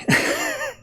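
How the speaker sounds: real high squeaky laugh; high burst of laughter. Real, not acted. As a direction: crack up suddenly. Reader breaks into laughter.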